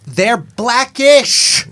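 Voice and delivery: slow, stretched "blackish"; slow drawl. Real, not acted. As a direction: drag the word out teasingly. Drawn-out joke word.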